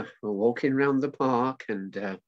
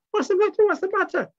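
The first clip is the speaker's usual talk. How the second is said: very high, louder squeaky puppet voice; Mickey's monkey voice; 5 dB louder than usual. Acted. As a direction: squeal it as a concerned little monkey. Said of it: "and Mickey says".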